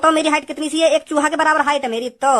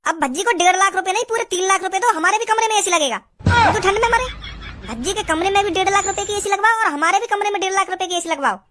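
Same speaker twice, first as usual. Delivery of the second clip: higher, nagging mother voice siding with her son; mother's voice; 5 semitones higher than usual. Acted. Mother takes her son's side with her husband, higher nagging voice. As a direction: play the mom scolding a stingy husband. Man voicing the mother in sketch.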